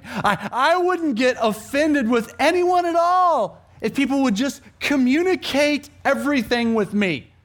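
high, loud, drawn-out grievance; exaggerated offended outburst; mock complaint. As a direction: build the hammed-up protest to a peak. Voicing an imagined complaining listener.

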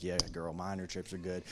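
lightly sassy friend going along; mock sassy agreement. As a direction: humor her, deadpan and low. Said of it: "I'm like".

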